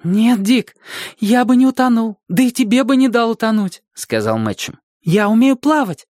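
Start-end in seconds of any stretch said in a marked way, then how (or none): 0.0-3.8 high-pitched voice for the exhausted companion; high character voice, insisting; boy companion. A narrator's reading. 5.0-6.1 high-pitched character voice; high voice for the young companion; boy companion. A narrator's reading. Companion's voice in narrated dialogue.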